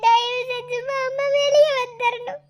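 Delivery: slow, drawn-out tearful begging; crying. Acted. Girl begging uncle to bring him out, crying. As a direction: sob out a guilty plea. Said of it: "அழாதக்கா".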